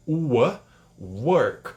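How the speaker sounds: "work" broken down slowly by sound. Shown as a demonstration. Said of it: "Então é som de A".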